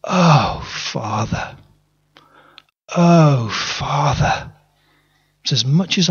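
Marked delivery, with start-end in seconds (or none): 0.0-4.5 a touch of despair, short broken calls; mild anguished cry. Retold or imitated. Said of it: "all I've been able to say is".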